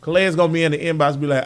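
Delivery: ordinary talk, nothing marked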